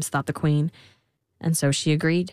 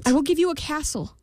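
higher, earnest queen voice; pleading queen; 9 semitones higher than usual. A narrator's reading. Queen bargaining with the imp, higher pleading. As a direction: offer it like a worried mother. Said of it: "said the queen".

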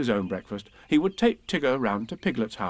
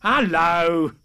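loud, higher animal greeting; strange animal; 5 semitones higher, 6 dB louder than usual. A narrator's reading. Strange animal answering Pooh's greeting, loud and drawn-out. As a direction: call back loudly as an excitable creature. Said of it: "said the strange animal".

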